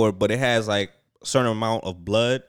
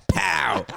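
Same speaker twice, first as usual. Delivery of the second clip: imitated smack; 6 semitones higher than usual. Retelling knocking the needle off the desk, explosive pow. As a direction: punch out a comic impact noise.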